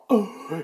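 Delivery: high, hammed-up gasp of fright; mock gasp. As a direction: gasp as if Hollywood scares you. Mock fear of scary people.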